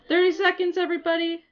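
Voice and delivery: high, quick, breath-catching alert; excited announcement. Real, not acted. Streamer warning everybody midnight is seconds away, excited announcement. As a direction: gasp and rally everyone excitedly. Countdown to New Year nearing.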